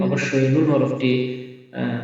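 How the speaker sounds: normal talking voice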